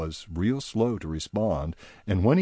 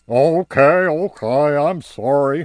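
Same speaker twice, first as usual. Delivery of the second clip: high, loud sheepish apology; High apologizing to Barnaby, high and loud; apologetic High; 5 semitones higher, 10 dB louder than usual. Acted. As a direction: back down quickly. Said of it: "High apologized".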